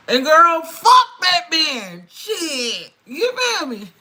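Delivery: a loud rant egging her viewer on; shouted cussing. Real, not acted. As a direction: belt it out in outrage.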